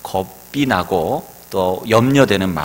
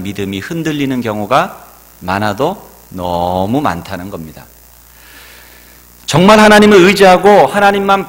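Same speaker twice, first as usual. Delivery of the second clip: higher and louder, driving the point home; fervent preaching; 4 semitones higher, 6 dB louder than usual. Acted. Pastor urging the congregation to trust God, fervent. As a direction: preach it full force from the pulpit.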